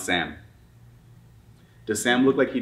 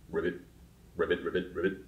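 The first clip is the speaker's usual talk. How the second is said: frog ribbit; 5 semitones lower, 9 dB quieter, faster than usual. Shown as a demonstration. Frog noise within the song.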